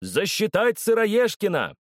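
slow, high drawn-out demand; hollering. A narrator's reading. Fat man rallies the crowd to count the run, hollering. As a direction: holler it, waving the cane. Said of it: "заголосил".

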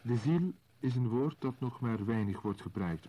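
ordinary talk, nothing marked

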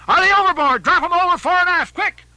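high, loud orders to the crew; frantic first mate's shout; urgent shouted orders. Acted. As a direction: yell commands down a storm-swept deck. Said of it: "cried Starbuck to the crew".

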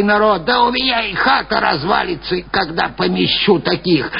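high-pitched, querulous crone; grumbling old woman. A narrator's reading. Old woman refusing three students lodging, grumbling. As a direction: scold them away in an old woman's voice. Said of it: "проворчала старуха".

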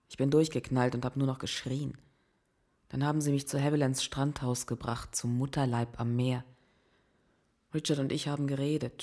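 usual speaking voice

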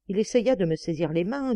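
usual speaking voice

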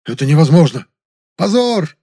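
high, loud cries of outrage; outraged shouting. A narrator's reading. Directors protesting the demands, outraged shouts. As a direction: call it out in loud indignation.

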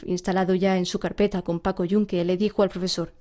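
plain reading voice

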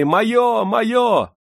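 очень высокий визгливый крик; жадно выкрикивают. A narrator's reading. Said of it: "кричали". (нищебродки кричат, хватая рублёвик)

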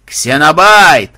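loud, drawn-out call; hollering. A narrator's reading. Banzai calling through cupped hands, hollering. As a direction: bellow the name into the distance. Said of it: "завопил Банзай".

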